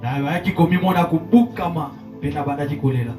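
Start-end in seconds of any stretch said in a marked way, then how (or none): none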